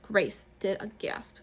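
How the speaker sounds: plain reading voice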